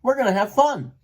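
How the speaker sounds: playful, lifted excitement; cheerful and upbeat. A narrator's reading. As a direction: cheer up your worried father. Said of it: "Elmer chided".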